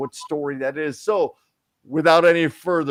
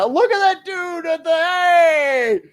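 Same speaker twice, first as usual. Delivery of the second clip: shouting; 6 dB louder, slower than usual. Real, not acted. Sudden shout at someone.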